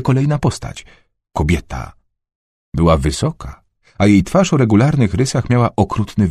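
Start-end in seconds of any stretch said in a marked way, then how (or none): none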